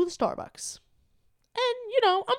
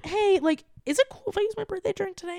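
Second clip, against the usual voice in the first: quick, high-pitched casual ask; 8 semitones higher than usual. Retold or imitated. Customer asking if birthday drink is cool, casual. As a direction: toss the request off lightly, imitating yourself. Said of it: "making it as casual as possible".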